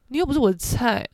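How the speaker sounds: ordinary talk, nothing marked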